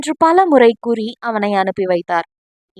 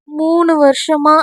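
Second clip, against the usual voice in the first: shy slow admission; 4 semitones higher, slower than usual. Acted. Veeri shyly confessing three years of love, slow and bashful. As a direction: admit it slowly, a little embarrassed.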